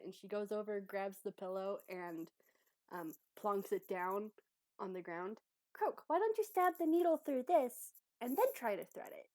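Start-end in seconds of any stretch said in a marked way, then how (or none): 5.7-9.3 high, loud, encouraging helper voice; keen helpful character. Acted. Abby tells Croak to stab needle through pillow, keen and helpful. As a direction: pitch the new idea keenly. Abby suggests a new method.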